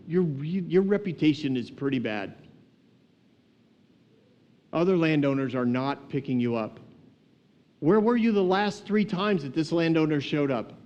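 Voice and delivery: usual speaking voice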